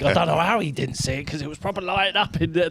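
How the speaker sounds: normal talking voice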